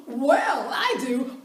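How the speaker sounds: loud, laughing rush of eagerness; eager laughing burst. Retold or imitated. Retelling her eager pitch to the coach, a loud laughing burst. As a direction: blurt it out with a laugh, too keen. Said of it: "So I took a big breath".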